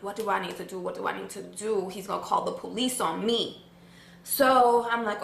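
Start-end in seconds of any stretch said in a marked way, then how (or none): 0.0-3.6 low, soft self-talk; quick, quiet worried muttering; hushed worry. Retold or imitated. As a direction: fret under your breath. Worried thoughts about the witness.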